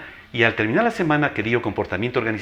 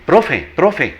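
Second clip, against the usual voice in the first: called out louder, a touch higher; calling out; 6 semitones higher, 6 dB louder than usual. Retold or imitated. Former student spotting the teacher, calling out. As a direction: hail the old teacher across the street. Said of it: "Otros me dicen".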